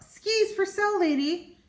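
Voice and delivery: a high, light hawker's call; shout. Acted. Rabbit selling skis to Sally, light mock shout. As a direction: call out like a street seller. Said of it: "he said with a shout".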